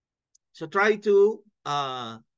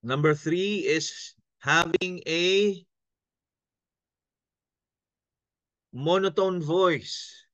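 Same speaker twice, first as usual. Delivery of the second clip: slow monotone; slower than usual. Shown as a demonstration. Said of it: "Iisa ang boses magmula simula".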